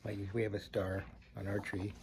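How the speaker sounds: quick murmured aside to the listeners; soft, rushed side comment; hushed aside. Real, not acted. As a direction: drop out of the story and mutter it fast. Quiet fast aside to listeners.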